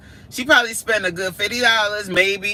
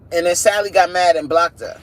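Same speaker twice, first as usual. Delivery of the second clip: ordinary talk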